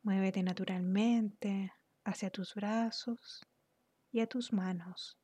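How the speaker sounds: unhurried, soothing instructions that ease the listener into relaxation; guided meditation. Real, not acted. Guide leads listener through relaxing the body, calm guided meditation.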